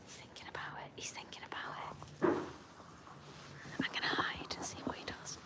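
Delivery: secretive whisper while hiding and watching; hushed whisper. Real, not acted. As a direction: whisper so the lamb won't hear.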